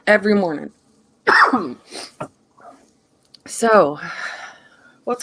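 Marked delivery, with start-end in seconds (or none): 1.2-1.7 throat clearing. Real, not acted. Allergies, coughing.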